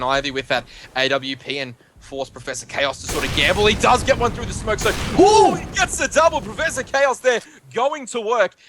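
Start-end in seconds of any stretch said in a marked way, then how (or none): 3.6-8.5 high, rising cry at the double kill; excited play-by-play. Real, not acted. Caster calls Professor Chaos's double through smoke, excited. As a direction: call the kill with a raised, thrilled voice.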